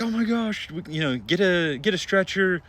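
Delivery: high, loud panicked call for a stretcher; retold panic, high and loud. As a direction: yell for help in a panic. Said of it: "So I'm like".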